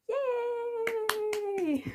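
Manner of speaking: long, high-pitched yay; drawn-out cheer. Pretend. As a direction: cheer in a high, held note for the children. Cheer ending the rhyme.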